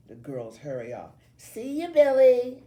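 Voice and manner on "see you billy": put-on high, loud girlish singsong call; calling back. A narrator's reading. Girls calling to Billy as they hurry off, calling back. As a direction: call it loudly over a departing shoulder. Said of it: "they call back to me as they go".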